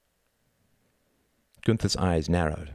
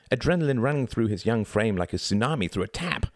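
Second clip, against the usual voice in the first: ordinary delivery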